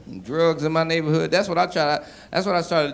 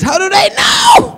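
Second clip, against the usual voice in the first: much higher, loud slow wail; anguished cry; 10 dB louder, slower than usual. Retold or imitated. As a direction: wail in mock despair. Comedian despairing.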